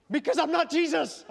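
high, loud comic protest; mock exasperated retort. Child answers mother comparing him to Jesus, comic protest. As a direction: yell back in mock exasperation. Acts exasperated reply to a parent.

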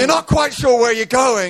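high-pitched dramatic sermon telling; impassioned preaching. Acted. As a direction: preach the story at full pitch. Preaches at full force.

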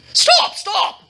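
high, sudden shout; king's order. A narrator's reading. King cutting off his historian, high urgent order. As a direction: cry out to halt the reader. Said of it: "the king ordered".

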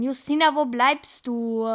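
loud, slow call across the room. Real, not acted. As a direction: call out to someone far away. Calling out for missing friend.

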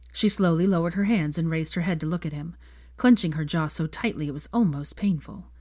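plain reading voice

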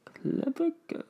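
tiny, very high soft voice; high-pitched mock quote. Trading Jurassic Park quotes with the co-host, squeaky. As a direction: squeak the movie line softly.

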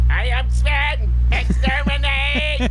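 high, slow robotic Dalek. Acted. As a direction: grate it out like a Dalek. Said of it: "Doctor Who... Dalek".

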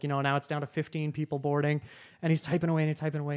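ordinary talk, nothing marked

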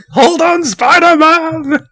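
very high, loud, drawn-out yell; mock dramatic cry. Film fan calls to Spider-Man mid-battle, mock dramatic cry. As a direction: call out in hammed-up alarm, stretching the name. Acted cry to falling hero.

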